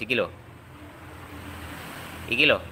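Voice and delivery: usual speaking voice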